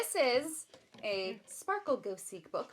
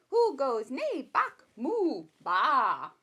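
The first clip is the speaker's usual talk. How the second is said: slow, higher sing-song question and answer; reading to a baby; 3 semitones higher, 5 dB louder, slower than usual. Acted. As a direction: draw the baby in with each animal. Reading picture book to baby.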